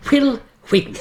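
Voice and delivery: Wheaton with a breathy H, laughing; extra h sounds. Shown as a demonstration. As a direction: say Wheaton as Hwee-ton. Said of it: "why are you pronouncing the H".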